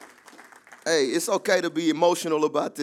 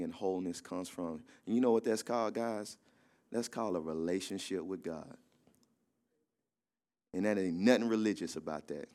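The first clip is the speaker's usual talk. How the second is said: earnest, hushed exhortation; 5 semitones lower, 5 dB quieter than usual. Real, not acted. Pastor urging congregation toward relationship over religion, hushed and earnest. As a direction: bring it right down and speak gently, deliberately.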